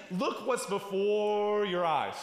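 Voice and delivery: slow, stretched-out appeal with a long held word; drawn-out urging. Retold or imitated. Paul urging his readers to look, drawn out.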